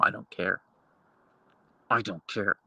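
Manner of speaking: ordinary talk, nothing marked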